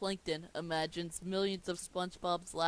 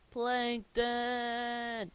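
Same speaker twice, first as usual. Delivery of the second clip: loud, higher, drawn-out shout; 6 semitones higher, 6 dB louder than usual. Acted. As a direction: call the name out in accusation. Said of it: "opens up the door and shouts".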